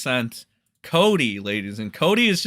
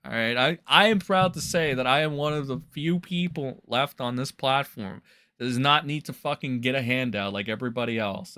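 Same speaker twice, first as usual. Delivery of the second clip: ordinary talk, nothing marked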